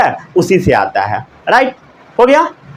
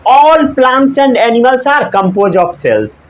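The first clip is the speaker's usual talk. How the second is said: slow, steady recitation; slow recap dictation; 3 semitones higher, much slower than usual. Shown as a demonstration. Teacher revising the cell theory with students, slowly. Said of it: "इस theory में क्या कहा गया?".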